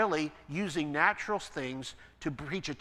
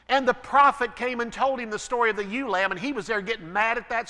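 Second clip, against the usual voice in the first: louder, higher, pressing preacher build; preaching at full force; 6 semitones higher, 5 dB louder than usual. Acted. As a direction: hammer the list of sins home from the pulpit. Preacher builds through David's sins.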